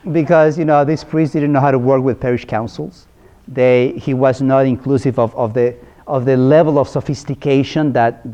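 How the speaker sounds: ordinary delivery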